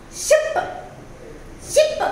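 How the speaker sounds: ship said very high with a short clipped i; teacher modeling ship, short i; model word ship. Shown as a demonstration. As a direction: pitch ship up for the child to hear. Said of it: "So, short".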